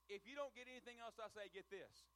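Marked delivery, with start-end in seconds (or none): none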